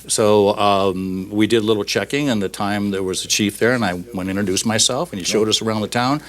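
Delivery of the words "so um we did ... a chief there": ordinary talk, nothing marked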